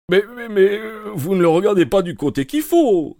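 high, loud flustered exclamation; high flustered inspector cries out; exclaiming. A narrator's reading. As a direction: sputter in protest. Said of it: "s'exclama-t-il".

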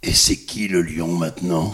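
low, swaggering dubbed lion taunt; movie line with the tone. Acted. Dubber redoing his film line for the quiz, low taunt. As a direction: drop the voice low and deliver the catchphrase. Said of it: "la dire avec le ton".

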